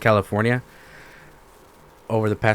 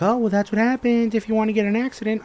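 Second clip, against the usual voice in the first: higher, airy imitation of a dismissive clerk; mimicking the DMV clerk brushing him off, higher put-on voice; mock clerk voice; 13 semitones higher than usual. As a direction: play the clerk as breezy and unbothered. Clerk voiced in a retold story.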